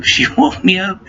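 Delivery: usual speaking voice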